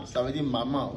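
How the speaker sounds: usual speaking voice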